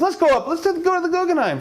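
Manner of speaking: high, chirpy urging; husband's eager push. Acted. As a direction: pitch way up, pushy cheer. Husband urges celebration.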